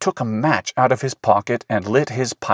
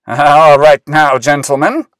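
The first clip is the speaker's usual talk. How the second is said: firm conductor; 4 semitones higher, 8 dB louder than usual. Pretend. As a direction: command the orchestra to attention. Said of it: "he said in a firm voice".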